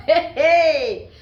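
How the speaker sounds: loud, high laughing hey; laughing shout at her own joke; laughing cheer. Real, not acted. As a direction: burst out laughing. Laughing exclamation.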